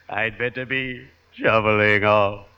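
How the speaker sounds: normal talking voice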